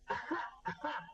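breathy, voiceless acted laugh; short storyteller's laugh in character; a brief breathy ha-ha; character's chuckle. Old boss laughs mid-boast.

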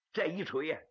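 usual speaking voice

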